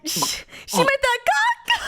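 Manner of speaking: high, slow, laughing wail; failed hammed-up impression of the woman; attempted voice. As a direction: crack up while trying the voice. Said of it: "I can't even do the voice".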